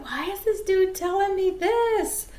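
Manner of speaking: high-pitched, baffled retelling; puzzled inner voice, high; incredulous. Retold or imitated. Acting out her puzzled reaction.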